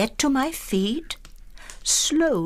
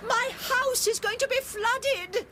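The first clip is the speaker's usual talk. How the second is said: panicked plea. Acted. Pleading as her house floods.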